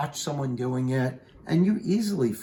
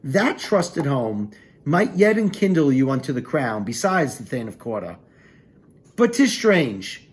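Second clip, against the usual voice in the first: Shakespeare recital; 3 semitones higher, 4 dB louder than usual. Acted. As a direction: declaim the lines, leaning on the warning. Teacher reads Banquo's warning aloud.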